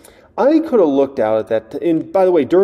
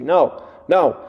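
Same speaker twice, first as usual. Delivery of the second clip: usual speaking voice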